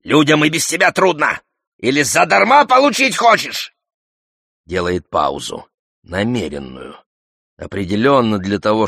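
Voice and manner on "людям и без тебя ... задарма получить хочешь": high-pitched heated challenge; quarrelsome shout. A narrator's reading. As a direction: throw it out as an angry taunt. (запальчивый крик в перепалке)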